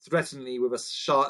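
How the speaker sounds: plain reading voice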